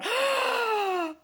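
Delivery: high imitated gasp of horror; horrified gasp. Imagined instructor gasping in horror, high-pitched. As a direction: gasp in mock shock, high.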